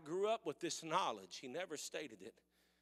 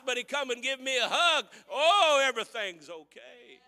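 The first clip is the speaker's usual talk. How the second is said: louder, higher preacher's cry of love; preaching; 10 semitones higher, 16 dB louder than usual. Acted. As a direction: proclaim it with pride. Preaching about his son.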